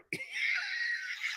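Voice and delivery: real high-pitched wheezing laugh; real laughing fit. Real, not acted. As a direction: break up into a shrieking laugh. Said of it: "I'm so sorry".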